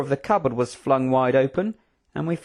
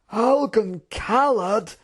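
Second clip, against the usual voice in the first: high, drawn-out disdainful query; scoffing; 8 semitones higher, much slower than usual. Acted. General dismissing the feared kingdom, scoffing. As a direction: stretch the name out with scorn. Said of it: "in a scoffing tone".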